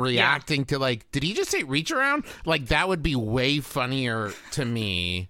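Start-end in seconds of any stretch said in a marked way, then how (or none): 1.1-2.2 high, amazed commentator question; incredulous host. Pretend. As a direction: gasp at what he said. Imagined live host reacting.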